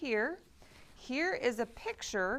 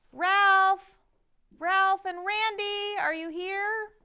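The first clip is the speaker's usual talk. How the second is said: loud, high call to someone offstage; 8 semitones higher, 8 dB louder, slower than usual. Acted. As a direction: call them out from backstage. Calling the puppets out.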